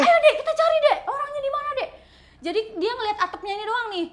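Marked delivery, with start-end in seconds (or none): 0.0-1.9 high-pitched excited urging, acted out; rally a companion to join the hunt; seru mengajak. Acted urgent search in mall. (ajakan heboh dengan suara tinggi)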